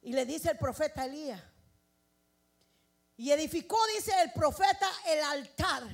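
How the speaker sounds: loud preacher proclaiming the story; full-force preaching. Acted. Preacher retelling Elijah's altar to the church, full-force preaching.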